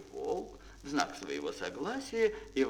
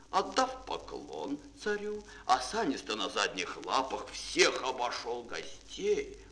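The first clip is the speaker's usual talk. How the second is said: plain reading voice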